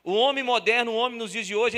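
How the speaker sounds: bem mais alto; enfático. Real, not acted. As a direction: brada à congregação. Pregação em crescendo. (pregação alta e enfática)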